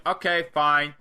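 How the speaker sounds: impatient dare, acted out; mock exasperated challenge. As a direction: imitate a wrestler losing patience. Voices fed-up wrestler challenging opponent.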